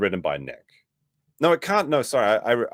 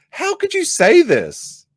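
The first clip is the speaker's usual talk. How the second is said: incredulous; 5 dB louder, slower than usual. Real, not acted. Incredulous outburst at the claim.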